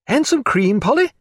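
high, lilting feminine request; lady calling out. Acted. Diana adding to her coffee order for Polly, high lilting call. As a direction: call lightly after the maid, pitched right up.

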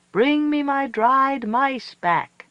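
high, slow howled plea; howling Coyote. A narrator's reading. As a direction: howl it into the night. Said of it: "She's saying".

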